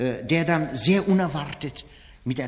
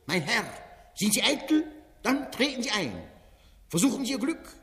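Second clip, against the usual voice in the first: Wenzel Wondrak, fairground barker; 3 semitones higher than usual. Acted. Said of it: "steht der Ausrufer Wenzel Wondrak".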